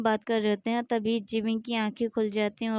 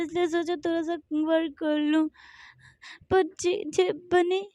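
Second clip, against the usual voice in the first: high, tearful pleading; crying; 7 semitones higher than usual. Acted. Jimin pleads with JK while crying, high and tearful. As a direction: plead through tears, pitched up. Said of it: "और ज़्यादा रोने लगता है".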